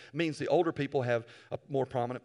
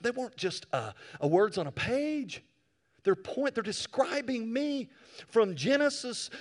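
ordinary delivery

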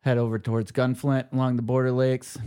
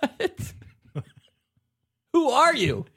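mock outrage; 6 dB louder than usual.

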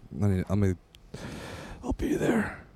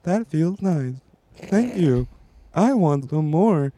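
higher, breathy robot moaning; a pleasure robot asking for more; sex robot voice; 5 semitones higher, slower than usual. Pretend. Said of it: "But right now it's".

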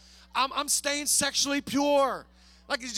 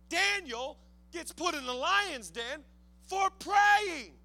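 raised, forceful sermon cry; emphatic preaching; 5 semitones higher, 4 dB louder than usual. Real, not acted. Preacher telling congregation who put Daniel in the den, emphatic preaching. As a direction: drive each point home, swelling louder.

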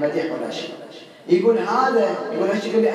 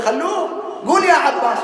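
raised, higher call to the patient; retold loud call; 10 semitones higher than usual. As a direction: call out the name with a laugh in it. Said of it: "قلت له". (نداء عالٍ مع ضحكة)

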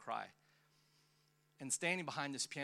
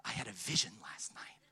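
mock whisper; 4 semitones lower than usual. Mocking people who claim visions.

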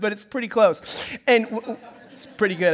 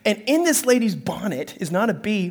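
usual speaking voice

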